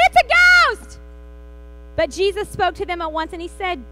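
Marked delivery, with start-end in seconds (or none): 0.1-1.0 high, loud terrified cry; frightened cry. A narrator's reading. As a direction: shriek it like you've just seen a ghost. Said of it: "In their fear, they cried out".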